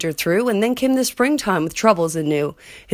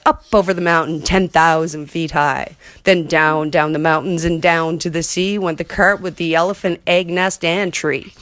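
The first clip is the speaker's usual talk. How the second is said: ordinary delivery, nothing marked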